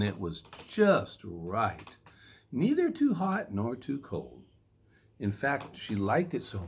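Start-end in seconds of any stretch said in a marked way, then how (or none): none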